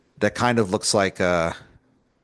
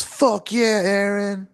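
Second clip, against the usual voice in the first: very high excited yell; yelling cheer; 11 semitones higher, slower than usual. Pretend. A constellation yelling praise at Aaron, very high. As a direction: whoop it like a fan. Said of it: "One of them yells".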